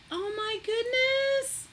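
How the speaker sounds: high, slow, stretched out; gushing at the gift's beauty; amazed. Real, not acted. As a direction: let it float up in disbelief. Seeing the gift.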